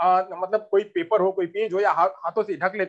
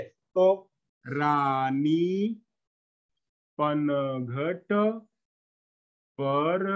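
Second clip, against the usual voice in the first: very slow and lower, words stretched with pauses; slow halting child reading; 4 semitones lower, much slower than usual. Shown as a demonstration. Teacher imitating a girl reading slowly, halting and stretched. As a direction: drag each word out like a child sounding it out. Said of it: "रुक रुक करके पढ़ रही है, धीरे धीरे".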